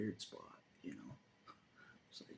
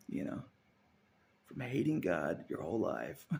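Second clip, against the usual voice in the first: ordinary talk, nothing marked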